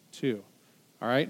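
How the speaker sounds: normal talking voice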